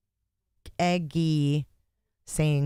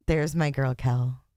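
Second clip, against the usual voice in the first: usual speaking voice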